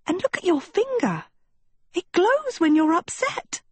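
put-on high-pitched girlish excitement; excited pointing out. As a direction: point it out eagerly, pitched well up. Sophie eagerly pointing at the finger.

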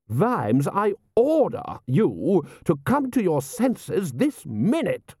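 high, slow, imperious outrage; lordly roar. A narrator's reading. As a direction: command him, stretching the words high. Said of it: "roared Lord Rust".

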